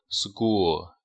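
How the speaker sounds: "school" with the k softened to a g, like "sgool"; k voiced as g. Shown as a demonstration. Teacher modelling a new word for students, "school" said with g for k. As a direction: say "school" with a hard g after the s. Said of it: "我们读的时候要读成g".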